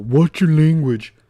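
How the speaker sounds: loud, high, drawn-out mockery; mocking scold. Streamer mocking the scolder from the clip, loud mock scolding. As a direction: parrot the scolder, loud and slow.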